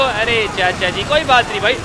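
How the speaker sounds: ordinary delivery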